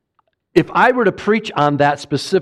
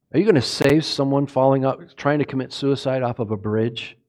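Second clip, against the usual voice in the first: usual speaking voice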